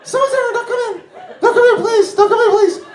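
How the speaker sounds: scared, squeaky falsetto plea; Mickey Mouse. Acted. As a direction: shriek very high in comic panic to keep someone out. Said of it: "I sound like Mickey Mouse".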